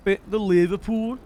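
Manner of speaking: put-on Liverpool accent. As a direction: have a shaky go at a Liverpool accent. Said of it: "Maybe I'll do the fucking Liverpool one".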